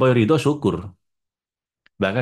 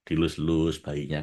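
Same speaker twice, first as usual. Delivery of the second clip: softer, lower and slow, tender; 5 semitones lower, 5 dB quieter, slower than usual. Retold or imitated. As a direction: linger lovingly, x0.6. Tender stroking of baby.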